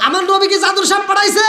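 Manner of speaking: high shouted rhetorical question; roused challenge. Shown as a demonstration. Preacher presses congregation to answer about his Prophet, shouted challenge. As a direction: raise it to a ringing challenge.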